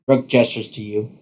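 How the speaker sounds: usual speaking voice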